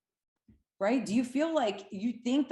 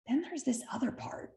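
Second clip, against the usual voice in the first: hushed, breathy reveal; whispering as if sharing a secret; 6 semitones higher, 5 dB quieter than usual. Real, not acted. Teasing reveal.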